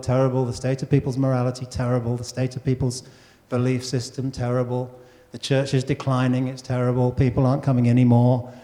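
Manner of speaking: droning lament in a low monotone; imitating gloomy grumblers; mock moaning. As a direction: moan through a list of woes on one low note. Voicing people moaning about the world.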